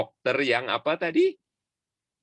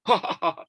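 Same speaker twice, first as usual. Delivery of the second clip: mock laugh; 8 semitones higher than usual. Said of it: "langsung ketawa".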